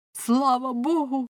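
high, drawn-out cry of thanks; relieved crowd crying out in thanks; exclaiming. A narrator's reading. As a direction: exclaim it slowly, pitched well up. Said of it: "вигукнули вони і перехрестилися".

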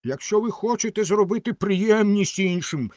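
high-pitched emphatic call; crying out. A narrator's reading. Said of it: "крикнула римська свічка".

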